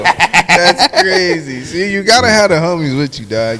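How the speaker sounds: usual speaking voice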